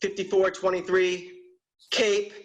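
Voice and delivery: spelling it out to a clueless clerk, getting fed up; exasperated spelling. Retold or imitated. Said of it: "I'm like".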